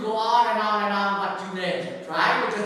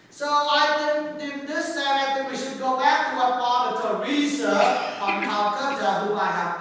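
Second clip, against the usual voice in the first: loud, higher-pitched sermon delivery; impassioned preaching; 7 semitones higher, 8 dB louder than usual. Real, not acted. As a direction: preach it out to the whole room. Preaches at full force.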